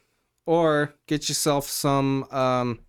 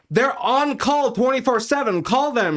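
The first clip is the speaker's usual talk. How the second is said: high, loud, heated comeback; raised-voice insistence, pitched way up; exasperated retort; 12 semitones higher, 5 dB louder than usual. Retold or imitated. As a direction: act out a fed-up manager arguing. Acted quarrel with district manager.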